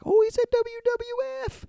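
high, giddy squeal of delight; childlike giddy squeal; giddy excitement. Retold or imitated. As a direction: gush like a thrilled kid. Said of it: "I was excited that he said that".

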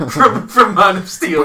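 laughing through words; chuckling mid-sentence. Real, not acted. Laughing at own mistake.

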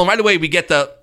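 ordinary delivery

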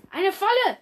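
high, loud alarm call; alarmed cry. Acted. Cat leader spotting a trap, crying out loud and high. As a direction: shout the warning to the others. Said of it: "rief Tannenstern".

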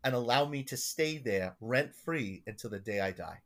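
put-on emphatic old schemer, thick Long Island accent; Gordon's Long Island accent. Parishioner sending his pastor to the priest, Long Island accent. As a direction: imitate an insistent Long Island eccentric. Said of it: "and this is how he talks".

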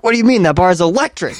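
hammed-up friend punching the final word "electric"; heated last-word emphasis. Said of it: "put a lot of emphasis on the last word".

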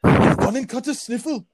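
high, drawn-out, hammy Scottish attempt; badly faked Scottish accent. Indy after sneezing hard, bad Scottish accent. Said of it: "He adopts a Scottish accent".

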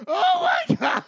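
high laughing scream of delight; laughing shriek. Real, not acted. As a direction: shriek it through a big laugh. Shrieking while laughing hard.

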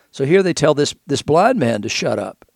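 usual speaking voice

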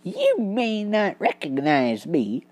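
quiet, composed detective; cool and unhurried, fully in control; calm. Acted. Basil calmly facing his captors, quiet and composed. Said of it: "declared Basil calmly".

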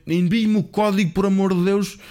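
high-pitched imitation of a threatening scammer; acted exasperated demand, high and insistent; mock threat. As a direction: re-enact his desperate threat, pitched right up. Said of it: "começa-me a ameaçar".

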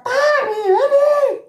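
high calling shout; shrill call for help; retold shout. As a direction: call her urgently from inside. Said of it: "y me grita".

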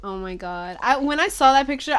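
ordinary talk, nothing marked

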